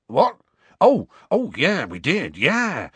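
loud, high, flustered response; startled. Acted. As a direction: snap out of a daydream. Hagrid startled from his reverie.

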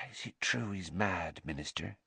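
very soft, hushed landlady; hushed and hesitant; sighing question. A narrator's reading. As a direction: ask softly on the tail of a sigh. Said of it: "let out a long sigh".

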